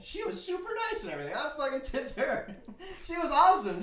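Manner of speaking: high, loud, laughing praise; bubbly laughing rave; 1 excited gushing. Real, not acted. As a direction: gush about her. Gushing about nice lady.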